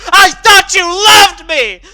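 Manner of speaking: loud, high, slow anguished wail. Acted. Scootaloo to her idol Rainbow Dash, heartbroken wail. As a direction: wail it like a betrayed little sister. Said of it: "she wailed".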